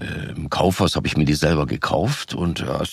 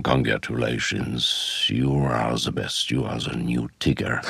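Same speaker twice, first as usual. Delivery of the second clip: put-on older gentleman; 6 semitones lower, 4 dB quieter than usual. Older gentleman congratulates him.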